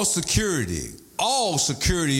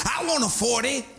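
imitating the ladies; 8 semitones higher than usual. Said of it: "That's what the ladies say".

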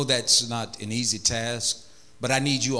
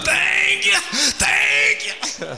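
high, loud, gushing thank yous; mock effusive thanks; 4 dB louder, much slower than usual. Preacher mimics a man gushing thanks, high and loud. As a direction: ham up a man who can't stop thanking. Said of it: "He wasn't done".

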